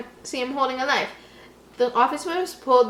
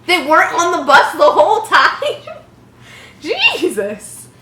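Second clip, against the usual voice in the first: loud, high laughing disbelief; 7 semitones higher, 8 dB louder than usual. Real, not acted. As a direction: crack up at the absurd plot. Reader laughing at the plot.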